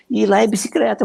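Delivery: imitando o cliente do sítio, voz mais aguda, dizendo bicicreta; rural accent. Retold or imitated. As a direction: caipira do sítio, "bicicleta" com r no lugar do l. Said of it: "porque eu cresci assim no sítio". (cliente do interior explica de onde vem, com sotaque caipira)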